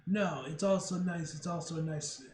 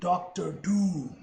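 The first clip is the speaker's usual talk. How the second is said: dramatic announcer; 5 dB louder, much slower than usual. Acted. Announcing villain.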